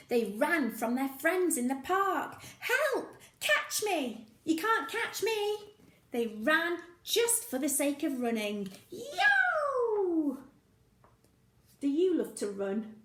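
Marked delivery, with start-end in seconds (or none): 2.6-5.6 high playful taunting; high, gleeful taunt; teasing child voice. Acted. Children teasing friends in park. 8.9-10.4 high loud yo; excited high shout; playful shout. Acted. Child calling out.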